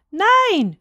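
high-pitched, drawn-out refusal; defiant childish cry. A narrator's reading. Boy refuses the good moon, defiant cry. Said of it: "schrie Häwelmann".

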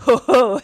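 short hooting guffaw; brief burst of mocking wolfhound laughter; howling laugh. A narrator's reading. Old wolfhound laughs at the hare's answer, short hoot. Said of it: "heulte der alte Wolfshund da auf".

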